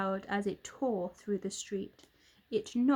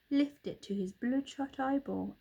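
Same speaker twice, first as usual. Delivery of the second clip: ordinary delivery, nothing marked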